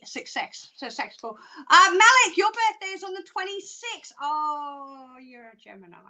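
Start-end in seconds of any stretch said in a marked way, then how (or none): none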